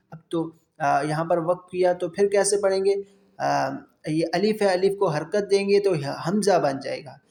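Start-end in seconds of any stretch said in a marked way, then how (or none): none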